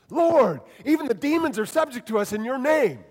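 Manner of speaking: high, loud and thrilled; excited. Shown as a demonstration. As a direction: burst out with joy. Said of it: "I imagine these seventy saying".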